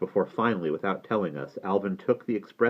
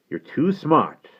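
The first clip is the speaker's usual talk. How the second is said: blunt boss voice, well above the narration; Abe lecturing; 9 semitones higher, 5 dB louder, slower than usual. Acted. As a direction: tell the youngster off. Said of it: "Abe told Alvin".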